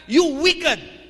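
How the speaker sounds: loud, high "You wicked" rebuke; scolding rebuke. Acted. The Lord scolding the lazy servant in a loud, angry rebuke. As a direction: scold him like an angry master. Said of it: "pinagalitan siya ng bonggang-bongga".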